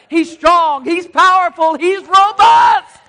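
climbing to a full shout; rising to a shouted peak; building preacher shout. Real, not acted.

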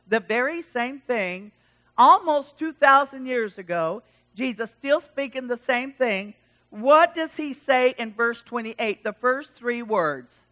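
ordinary delivery

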